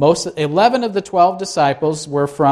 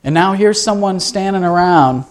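ordinary talk

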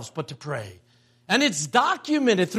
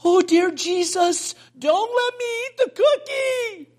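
put-on child's pleading prayer; 11 semitones higher than usual. Imagining the grandson praying against temptation, high mock pleading. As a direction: pretend to be a desperate little boy praying. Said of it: "fold his little hands and go".

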